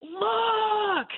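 very high, loud shouted command; roar. Retold or imitated. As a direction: bellow it so it shakes the ground. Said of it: "a roar that I actually felt".

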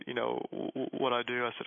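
ordinary talk, nothing marked

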